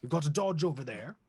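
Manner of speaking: high, prim duck butler; lightly high duck servant identifying cars. Acted. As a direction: clear the throat and report primly, up high. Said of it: "Sir William looks and goes".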